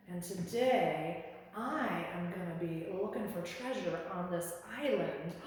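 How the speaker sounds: pirate. Pretend. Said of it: "we're gonna be a pirate".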